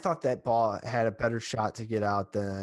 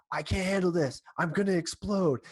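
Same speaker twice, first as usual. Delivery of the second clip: higher mock-panicked player voice; panicky Rizzo; 7 semitones higher than usual. Rizzo telling Ross he can't cope, higher mock panic. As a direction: ham up a player losing his nerve. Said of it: "Rizzo's like".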